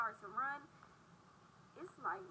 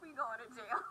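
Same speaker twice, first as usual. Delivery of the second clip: laughing panic; 4 dB louder than usual. Retold or imitated. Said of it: "I'm sitting here like".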